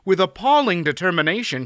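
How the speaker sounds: plain reading voice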